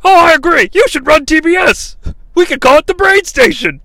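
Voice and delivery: high, loud fawning commentator; Bobby Heenan. Acted. Commentator sucks up to the boss, high put-on voice. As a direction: flatter the boss in a high excited voice. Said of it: "And Bobby's like".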